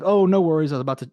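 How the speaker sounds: high, loud gushing reply; corny, overeager. Shown as a demonstration. Rep gushes way too eagerly at a rude lead. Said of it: "corny with it".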